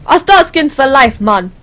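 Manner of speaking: put-on loud, improvised Jamaican accent. Soccer player cheering on the team, in a Jamaican accent. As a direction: shout it with a touch of Jamaican. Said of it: "I don't know why that was Jamaican".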